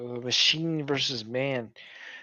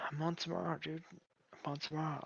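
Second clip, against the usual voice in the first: disappointed sigh; 17 dB quieter than usual. Real, not acted. Sighing, very quiet.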